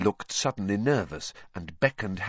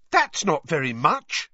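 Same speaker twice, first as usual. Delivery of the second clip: raised, louder indignant protest; indignant shout; 6 semitones higher, 5 dB louder than usual. A narrator's reading. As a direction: call it out in indignation. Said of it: "Hermione shouted indignantly".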